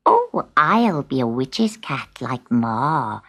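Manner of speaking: a lightly voiced kitten dreaming of magic; young witch kitten. Sutika telling her brother her dream, slow and low. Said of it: "said Sutika".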